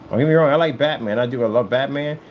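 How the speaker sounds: ordinary delivery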